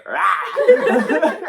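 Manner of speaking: loud laughing [name] style rah; [name] roar. Retold or imitated. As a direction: bark it out like [name]. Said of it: "I'm like".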